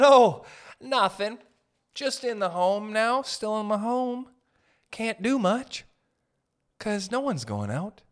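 high, slow, bored mock voice; bored friend. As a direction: whine it like nothing ever happens.

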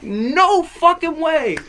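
very high, slow incredulous cry; mock disbelief. Guy reacting to everybody praising bad rapping, incredulous.

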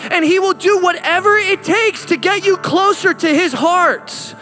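full-force preaching. Acted. Pastor driving home God's message, climactic preaching. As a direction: lift it up and pour it out over the room.

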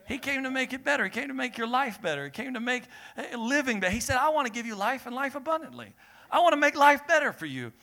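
rising preaching fervor. Acted. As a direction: lift it up and preach it. Preaching builds.

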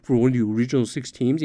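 quick, lightly put-on grateful rookie voice; mock polite draft pick. Host mocking the polite young prospect, put-on thanks. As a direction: rattle off the humble thankful line. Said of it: "he's just gonna cave and be like".

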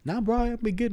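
high, soft fake innocence; innocent act. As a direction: play it innocent. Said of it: "I'm like".

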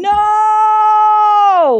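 long, high, loud wail of "No!"; anguished wail. Acted. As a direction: cry out in anguish, drawing it out. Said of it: "Peter probably dropped to his knees and".

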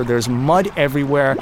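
usual speaking voice

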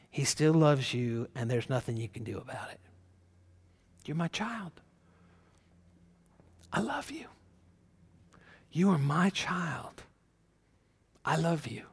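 hushed, reassuring, with long pauses; tender divine whisper. Acted. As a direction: softly voice God whispering love to a child. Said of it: "He's whispering. Can you hear Him?".

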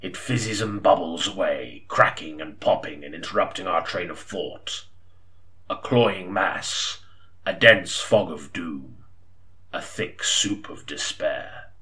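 slow, low, doom-laden musing; gloomy monologue. Acted. Pondering gloom aloud to himself, slow and low. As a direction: drag out each image of despair.

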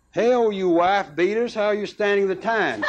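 high-pitched mild mock bellow; understated storyteller's bellow; bellowed taunt. A narrator's reading. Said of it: "we bellowed in front of the jail".

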